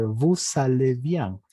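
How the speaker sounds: slow "vous allez bien", the S of "vous" sounded into "allez"; liaison S pronounced. Shown as a demonstration. Teacher shows the rule before a vowel, "vous allez bien" with sounded S. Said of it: "la S sí se pronuncia".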